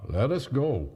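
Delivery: ordinary delivery, nothing marked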